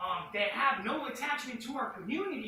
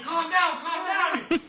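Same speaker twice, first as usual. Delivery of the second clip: loud, high imitation of someone calming him; retold urgent plea; 8 semitones higher, 13 dB louder, slower than usual. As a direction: call it out over an argument, much louder and higher. Companion urging him to calm down.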